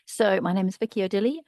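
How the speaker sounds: ordinary delivery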